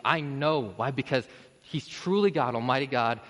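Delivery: ordinary talk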